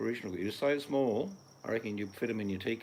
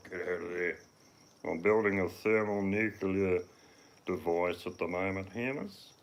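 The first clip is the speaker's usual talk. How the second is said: a hint of a put-on zany voice, slowed; lightly voiced madcap inventor; mock mad scientist reading; 4 dB louder than usual. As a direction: read the comment as a daft character. Reading out a viewer comment.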